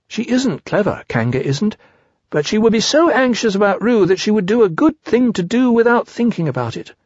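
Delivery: high little storybook voice; Piglet in earnest. Acted.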